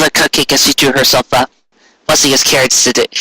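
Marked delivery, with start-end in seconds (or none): none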